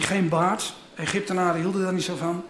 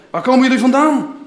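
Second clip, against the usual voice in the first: louder, higher stern demand; gruff tone; 4 semitones higher, 7 dB louder than usual. Acted. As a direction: Joseph questioning his brothers, louder and stern. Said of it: "vroeg op barse toon".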